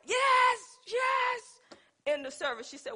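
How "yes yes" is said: high, loud mock holler of yes; retold holler. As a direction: holler it like she's overcome by the spirit. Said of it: "just hollering like".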